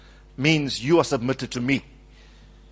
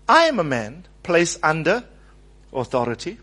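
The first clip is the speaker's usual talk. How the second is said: ordinary talk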